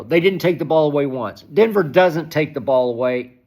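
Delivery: ordinary delivery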